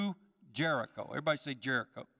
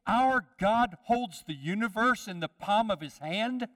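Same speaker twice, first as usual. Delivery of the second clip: proclaimed loudly; 5 semitones higher, 5 dB louder than usual. Shown as a demonstration. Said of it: "Doesn't the Bible say".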